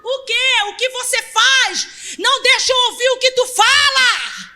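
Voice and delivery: high, loud and slowing challenge; loud pulpit retort; shouted rebuke. Acted. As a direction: shout it back at the crowd.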